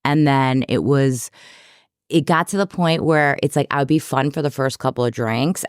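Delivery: usual speaking voice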